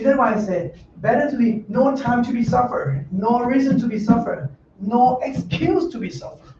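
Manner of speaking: normal talking voice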